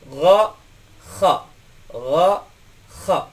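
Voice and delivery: "Gha" voiced gargle and "kha" rasping scrape from the upper throat; teacher models the back-throat letters; Arabic gha and kha. Shown as a demonstration. Teacher demonstrating to students, gargled throat sounds. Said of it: "dari tenggorokan bagian atas".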